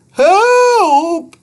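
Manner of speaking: high loud cry for help; holler for help. A narrator's reading. Boy sinking in an oil pit, hollering. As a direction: yell it as you sink. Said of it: "Andrew hollered".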